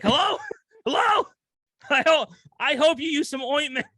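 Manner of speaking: high-pitched retold calling into phone; mock calling out. Improviser calling after the caller who hung up, high mock call.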